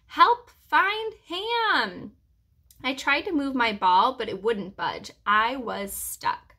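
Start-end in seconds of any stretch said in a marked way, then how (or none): 0.1-2.1 high, very drawn-out call for help. A narrator's reading. Searcher calls for help finding the hamster, calling out. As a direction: stretch each word out high across the distance. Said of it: "calling my name".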